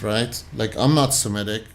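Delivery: ordinary talk, nothing marked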